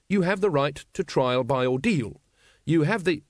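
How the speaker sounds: earnest constable's formal recital; official reading. Acted. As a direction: plod dutifully through the legal wording. Carrot continues reading rights.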